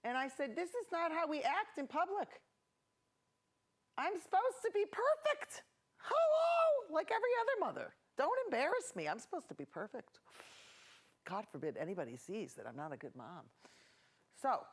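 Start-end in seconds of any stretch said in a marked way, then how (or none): 0.5-2.4 higher-pitched retold scolding; hammed-up telling-off; mad mom. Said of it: "So I got mad". 4.0-5.6 high-pitched hammed-up outburst; rising into a mock mom tantrum; overacted, indignant high-pitched cry; mad mom. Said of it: "So I got mad". 6.0-9.1 very high, loud mock screech; hammed-up mom meltdown; mad mom yelling. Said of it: "So I got mad". 9.1-13.5 low, soft retold grumble; quieter, lower tail of a rant; muttered self-pity. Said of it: "that's what I told him".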